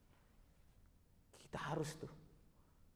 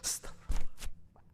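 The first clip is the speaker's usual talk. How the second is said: slow, voiceless prayerful whisper; murmured istighfar; slower than usual. Acted. Mourner seeing the parent's photo, whispered plea for forgiveness. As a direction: breathe it out slowly under your breath. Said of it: "sambil istighfar".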